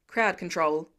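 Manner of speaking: low, loud barked order; chief barking. A narrator's reading. Fire chief barking an order at Peter, low and curt. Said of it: "the chief barks".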